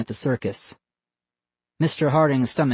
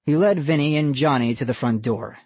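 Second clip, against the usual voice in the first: usual speaking voice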